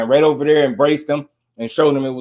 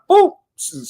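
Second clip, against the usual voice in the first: high switch sound. Retold or imitated.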